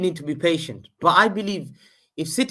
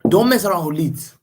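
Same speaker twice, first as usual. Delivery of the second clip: "Leeds" in a louder, firm warning; corrected warning, louder; louder restated warning; 8 dB louder than usual. Real, not acted. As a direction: say it loud and firm. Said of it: "I didn't say dogs, but players".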